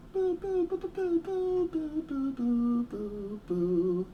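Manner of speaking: boo in a high teasing falsetto. Pretend. Playful booing.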